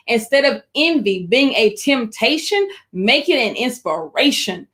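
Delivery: slowed down, each phrase spelled out clearly. Shown as a demonstration. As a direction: take the line again at half speed, like a teacher making sure it lands. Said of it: "Let me say that slower".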